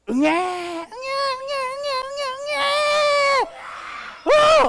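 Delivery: put-on high wailing baby cries; piercing baby wail, rising to a shriek; baby crying. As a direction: wail like a newborn next door. Imitating a crying baby next door.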